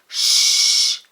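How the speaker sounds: sharp hissed shh; loud shush. A narrator's reading. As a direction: shush him hard. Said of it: "said Bert".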